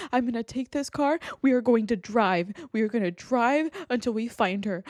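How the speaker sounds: normal talking voice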